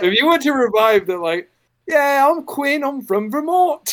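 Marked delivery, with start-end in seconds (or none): 1.9-3.9 high, hammed-up British accent; playful fake British voice for the character; teasing demo of a British accent; mock British accent. Shown as a demonstration. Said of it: "If you would like to speak with a British accent".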